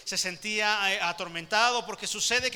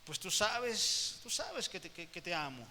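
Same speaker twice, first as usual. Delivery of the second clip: much softer, slow and halting; downcast, faltering reply; 7 dB quieter, slower than usual. Acted. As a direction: falter out the answer, ashamed. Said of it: "otra vez con la mirada agachada". (respuesta avergonzada, suave y entrecortada)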